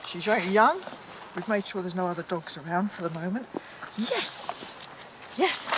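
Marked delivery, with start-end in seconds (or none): 4.0-5.7 high excited praise; squeaky praising a dog. Real, not acted. Praising puppy off lead.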